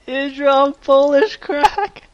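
squeaky giggling repeat, very slow; barely getting it out through laughter; laughing. Real, not acted. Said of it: "Why is that so funny?".

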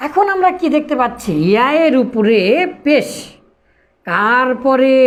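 ordinary talk

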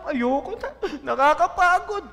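high, laughing mock whine of tiredness; hammed-up weary complaint, breaking into laughter; mock complaining. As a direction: imitate someone giving up over nothing. Mimics people quitting over small problems.

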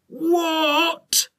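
high, startled bark of a factory boss; roaring Tugbury. A narrator's reading. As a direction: boss rushing in at breaking glass. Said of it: "roared Tugbury".

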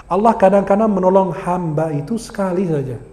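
ordinary delivery

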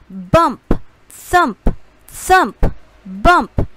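loud, punchy bump and thump; punched sound words. A narrator's reading. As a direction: hit each word like a thud. Sound words of kites hitting wall.

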